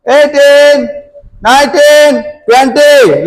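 counting workout reps. Real, not acted. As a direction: call out each rep to the class. Coach counts reps aloud.

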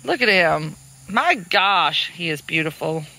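normal talking voice

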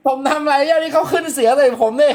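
giggling, high-pitched denial; laughing protest. Real, not acted. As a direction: squeal it out while cracking up. Laughing while protesting innocence.

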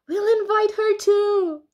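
cheery penguin. A narrator's reading. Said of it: "said another penguin".

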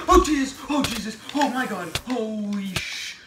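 jump-scared panicked shouting; real fright. Real, not acted. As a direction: shriek in real shock at a sudden scare. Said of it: "that genuinely scared me".

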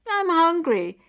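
high, loud demanding call; loud, high-pitched call for attention. A narrator's reading. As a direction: holler it to your sister across the yard. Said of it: "she called out to her sister".

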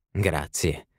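usual speaking voice

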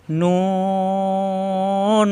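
নুন with the vowel held very long; drawn-out letter name. Shown as a demonstration. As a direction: hold নুন on one level note. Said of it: "এখানে কিন্তু টান দেওয়া যাচ্ছে".

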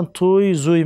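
توی and زوی with an added oy ending; mispronounced letter names. Shown as a demonstration. Teacher quoting wrong letter names, Persian-style pronunciation. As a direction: say توی، زوی as "toy, zoy".

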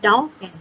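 dolphin said softly and clearly; teacher modelling dolphin; model word. Shown as a demonstration. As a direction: repeat dolphin for the pupils. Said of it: "คำนี้ dolphin".

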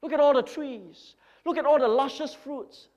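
loud, high bragging over his land; a proud landowner crowing over his wealth; boastful rich man. Acted. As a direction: show off every field you own. Preacher acts a greedy boaster.

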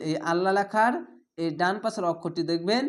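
ordinary talk

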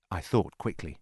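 usual speaking voice

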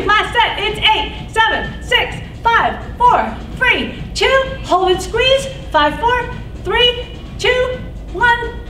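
high, pumped-up counting cues; aerobics instructor. Acted. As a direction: hype the class through every count. Counts pulses in class.

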